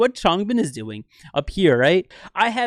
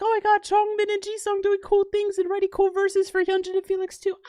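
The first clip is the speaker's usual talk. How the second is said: high excited fan squeal; mock fan gush. Coach pretending to gush like an amazed fan. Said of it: "I'd be like".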